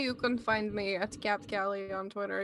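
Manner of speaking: lightly put-on Russian accent. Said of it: "I don't know why I'm speaking with a Russian accent".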